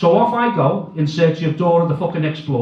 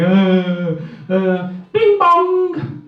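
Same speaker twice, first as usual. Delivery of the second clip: tannoy chime; 2 semitones higher, much slower than usual. Retold or imitated. Tannoy chime before a lost-child announcement. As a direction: sing the shop tannoy chime. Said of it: "I'm shitting impression".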